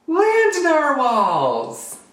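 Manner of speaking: high, very slow, awed exclamation; amazed discovery. Acted. Little narwhal first sees unicorns, drawn-out amazement. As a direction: stretch it out in wonder.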